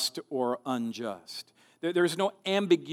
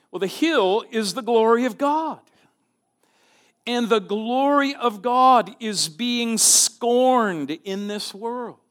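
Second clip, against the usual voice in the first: higher, louder, rising sermon climax; preaching at full force; 7 semitones higher, 6 dB louder than usual. Acted. Pastor exhorting the congregation about God's glory, fervent preaching. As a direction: build it up, proclaiming God's glory.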